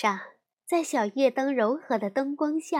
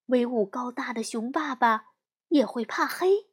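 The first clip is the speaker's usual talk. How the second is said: ordinary delivery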